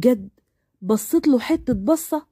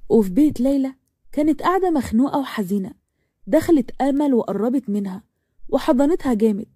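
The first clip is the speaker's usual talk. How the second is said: plain reading voice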